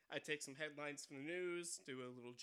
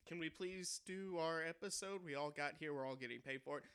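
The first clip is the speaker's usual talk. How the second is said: ordinary talk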